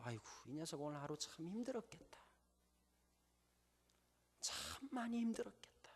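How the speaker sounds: put-on soft, hushed whisper to a sleeping child; whispered pity for a tired daughter; tender whisper. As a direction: murmur it so she won't wake. Father over sleeping daughter.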